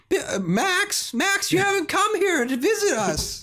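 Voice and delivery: put-on very high, slow old granny voice; high quavering grandma guilt-trip; grandmother voice. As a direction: whine like a lonely grandma on the phone. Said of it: "for your grandmother where you can call me".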